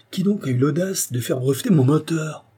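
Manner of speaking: high-pitched indignant question; outraged demand. A narrator's reading. Outraged inventor demands who betrayed him. (indignation, voix haut perchée)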